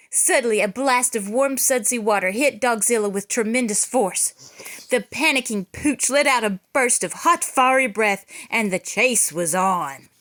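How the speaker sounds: usual speaking voice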